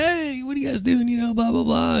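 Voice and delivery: high, light voice for a friendly passerby; cheerful stranger. Retold or imitated. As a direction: greet the group like a curious visitor. Husband and wife walk in.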